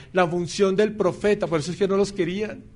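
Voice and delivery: ordinary talk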